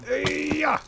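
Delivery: high, sharp attack yell; playful high war cry; battle cry. Real, not acted. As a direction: yell as you swing. Attacking skeleton.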